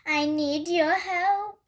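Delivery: slow loud shout for help. Acted. Cat shouting to Hedgehog for help, loud and slow. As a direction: bellow the plea slowly. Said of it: "Why are you shouting?".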